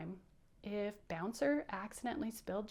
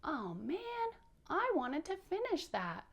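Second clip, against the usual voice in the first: higher-pitched, mild whine of disappointment; disappointed; 8 semitones higher than usual. Shown as a demonstration. Teacher leading kids to sound like disappointed Bouncer. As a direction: demo the puppet's let-down voice. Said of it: "Can you do that like him?".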